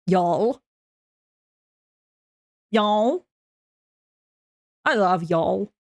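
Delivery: low, tentative American Southern twang; lightly attempted American Southern; attempted Southern accent. Shown as a demonstration. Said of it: "American Southern accent".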